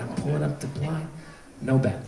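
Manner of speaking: low, hushed suspense; tense hush. Retold or imitated. Preacher recounting pulling up the blind in the bat hunt, tense and hushed. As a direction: drop to a soft, low storytelling hush.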